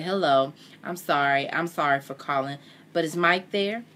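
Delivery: ordinary delivery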